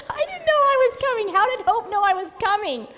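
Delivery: high, loud, fast bewilderment; retold disbelief. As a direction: laugh and exclaim it in amazement. Retold disbelief, growing louder.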